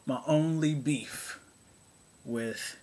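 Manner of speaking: normal talking voice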